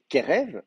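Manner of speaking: "Kerev" with the weight on KE, the second syllable light; stress on first syllable. Shown as a demonstration. Teacher shows students where "Kerev" takes its stress. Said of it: "l'accent est sur la première syllabe".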